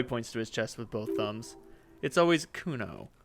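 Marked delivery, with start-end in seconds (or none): none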